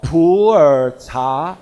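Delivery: "pu'er cha" said the Chinese way, slowly, as pu-er cha; slow Mandarin demo of "pu'er cha", each syllable clear; Mandarin pronunciation. Shown as a demonstration. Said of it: "In typical Chinese pronunciation".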